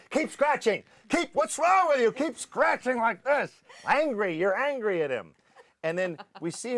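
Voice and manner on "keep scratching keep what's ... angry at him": high, loud pushing direction; urgent director. Retold or imitated. Director urging actress to scratch harder, loud insistent. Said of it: "Sam kept going".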